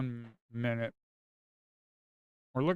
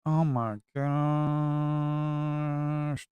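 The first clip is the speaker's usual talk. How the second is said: drawn-out bored groan; 3 semitones higher, much slower than usual. Real, not acted. Bored watching a flat market.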